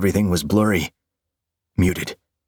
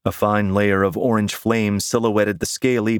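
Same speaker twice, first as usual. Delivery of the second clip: plain reading voice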